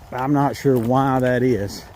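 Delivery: ordinary talk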